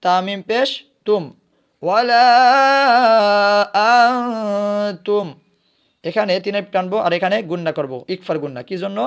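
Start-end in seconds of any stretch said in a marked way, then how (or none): 1.8-5.3 slow chant, higher and louder, last word held long; Quranic recitation. Shown as a demonstration. Teacher reciting for the pupils, drawn-out chant. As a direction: hold the final word as a melodic note. Recites verse after spelling.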